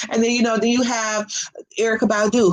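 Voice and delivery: ordinary talk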